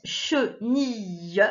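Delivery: "chenille" very slowly, "che-ni-yeu"; syllable by syllable. Shown as a demonstration. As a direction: stretch each syllable of "chenille". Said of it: "derrière, on a le E". (« che-ni-lle » très lentement, syllabes étirées)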